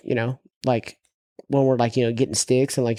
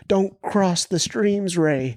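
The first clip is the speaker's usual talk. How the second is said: slow, higher movie-line delivery; Ghostbusters quote; 6 semitones higher, much slower than usual. Retold or imitated. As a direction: ham up the famous warning. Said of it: "we're reenacting, like, Ghostbusters".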